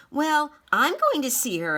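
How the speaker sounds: high, loud, determined announcement; child announcing her bold plan. Acted. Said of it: "Elise announced".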